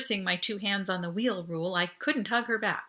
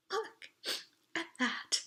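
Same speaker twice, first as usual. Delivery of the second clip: teary, unvoiced, moved; almost crying with wonder; sniffling; 9 semitones higher, 7 dB quieter, slower than usual. A narrator's reading. Audrey tearful seeing her first footprint, sniffling softly. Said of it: "she was sniffling".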